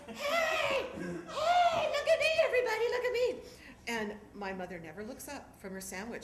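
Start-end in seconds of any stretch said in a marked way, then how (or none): 0.1-3.4 much higher, louder laughing yell. Retold or imitated. Dad in the rafters shouting to the crowd, high laughing yell. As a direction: shout gleefully to everyone. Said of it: "He's yelling".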